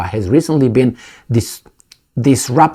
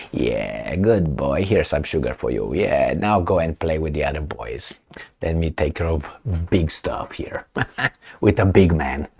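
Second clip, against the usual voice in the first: much lower, softer condescending pat on the head; patronizing master voice; 8 semitones lower, 10 dB quieter than usual. Retold or imitated. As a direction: talk down to a good little boy. Mocking US patting a lapdog.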